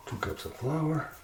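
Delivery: normal talking voice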